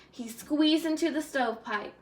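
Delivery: ordinary delivery, nothing marked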